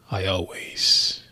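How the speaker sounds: slow menacing whisper. Acted. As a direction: hiss it like a threat. Dramatic villain line whispered.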